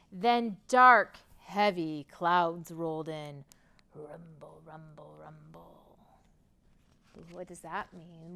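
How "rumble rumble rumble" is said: very soft, low thunder imitation; low rumbling. A narrator's reading. Narrator imitating dark clouds rolling in, low rumbling. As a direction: murmur it deep like far-off thunder. Sound of distant storm clouds.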